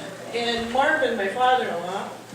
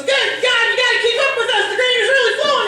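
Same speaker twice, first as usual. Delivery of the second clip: much higher, louder hurried yell; retold yell; 11 semitones higher, 7 dB louder than usual. Brother-in-law yelling at the new bride to keep up, loud hurried yell. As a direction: bark it out in a rush while laughing. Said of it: "he goes".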